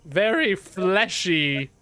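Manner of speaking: high, slow, self-important drawl; preening high-pitched humanoid; Tech's haughty voice. Acted. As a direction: grandly offer your services. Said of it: "And she goes".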